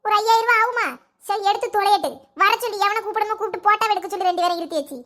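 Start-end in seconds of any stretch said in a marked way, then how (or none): none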